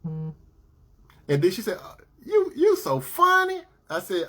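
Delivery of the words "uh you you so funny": high flirty woman. Retold or imitated. Woman laughing at his jokes.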